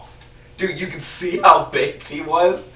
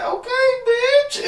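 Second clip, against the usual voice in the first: much higher, slow, strained sarcastic falsetto; sarcastic squeal; 5 dB louder, slower than usual. Retold or imitated. Reacting to the mean bartender with a high sarcastic squeal. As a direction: squeak it out in mock offence. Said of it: "I was like".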